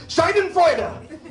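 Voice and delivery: high, slow, stretched-out yell of the word; hammed-up shout of relief; mock outburst. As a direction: blurt the word out like a release. Shouting the safe word at checkout.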